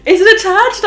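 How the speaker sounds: high, loud panicky question; retold freak-out. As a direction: act out frantic checking, pitch shooting up. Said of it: "I was freaking out, being like".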